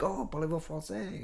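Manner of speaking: mock French. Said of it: "strong French or German accent".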